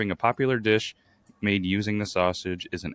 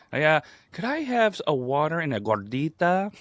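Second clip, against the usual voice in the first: gordita said with a high put-on Spanish accent; mock Spanish accent; 8 semitones higher than usual. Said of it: "emphasizes the word like they're from".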